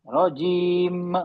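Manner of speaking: رجیم held very long, lips closed at the end; very slow model reading of رجیم; drawn-out recitation demo. Shown as a demonstration. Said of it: "ہونٹوں کو آپ کھول دے رہی ہیں آخر میں".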